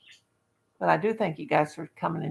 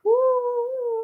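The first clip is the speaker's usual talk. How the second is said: whoop. Real, not acted. Cheering whoop.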